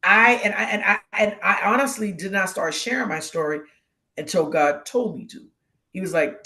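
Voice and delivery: ordinary talk, nothing marked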